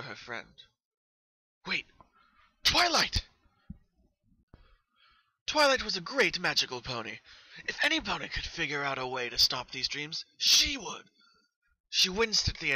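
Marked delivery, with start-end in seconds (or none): 1.7-11.0 high, loud burst of hope; sudden realisation. Acted. Pinkie suddenly realising Twilight can help, excited high cry. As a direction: leap up at the idea.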